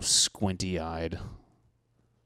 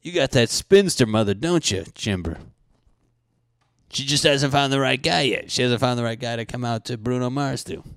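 mock taunting; 4 semitones higher, 6 dB louder than usual. Mocking coworker about spinster mother.

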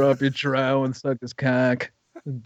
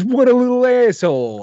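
higher-pitched mock quote; lightly acted, raised-pitch jab at someone; imitating a film character; 11 semitones higher, 4 dB louder than usual. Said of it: "he sits there and goes".

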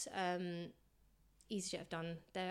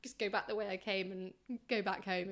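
ordinary delivery

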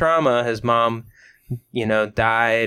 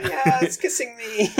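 high, giggly through laughter; laughing mock glee; 12 semitones higher than usual. Guy rolling with the dog.

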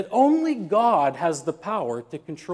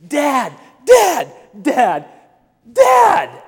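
put-on high, loud child's yell for Dad; child screaming; 9 semitones higher, 9 dB louder, much slower than usual. Kid screams for Dad during the nap, loud and high. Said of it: "just screaming".

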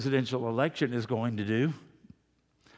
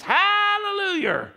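much higher, slow, loud cry of Hallelujah; hammed-up shout; 6 dB louder, slower than usual. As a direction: belt it out like an overjoyed country churchgoer. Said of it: "You know how they said it like that".

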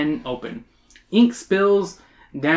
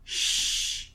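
soft, voiceless shush; whispered hush; 9 dB quieter than usual. Acted. Mister Scully silencing Emily, whispered hush. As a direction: hush them gently under the breath. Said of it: "he whispers".

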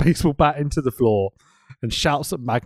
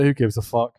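usual speaking voice

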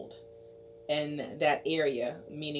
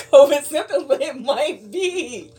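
laughing; 9 semitones higher, 12 dB louder than usual. Real, not acted. Laughs through joke.

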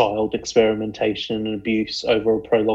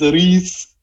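put-on Welsh accent; 6 semitones higher, 4 dB louder than usual. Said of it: "did you just attempt a Welsh voice".

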